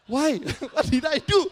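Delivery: high, loud mock-indignant outburst; hammed-up bewildered yelp; exasperated protest. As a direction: protest your innocence loudly. Acted protest at being accused.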